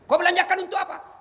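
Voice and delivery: high questioning of the dead; acted interrogation. Questioner asks poor man how he spent his pay, high mock interrogation.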